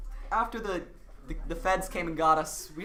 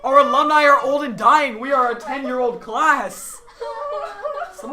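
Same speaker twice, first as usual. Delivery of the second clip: laughing while talking; 6 semitones higher, 9 dB louder than usual. Real, not acted. Laughing through the announcement.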